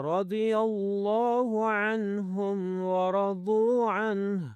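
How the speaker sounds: slow, drawn-out tajweed chant; Quran recitation. Shown as a demonstration. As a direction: recite with long held vowels.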